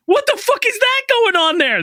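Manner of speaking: high-pitched, incredulous outburst; baffled visitor. Retold or imitated. Visitor to villagers about strange lights, baffled.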